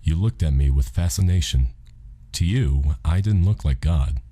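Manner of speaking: ordinary delivery, nothing marked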